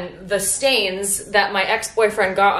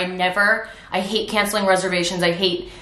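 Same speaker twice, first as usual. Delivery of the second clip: ordinary delivery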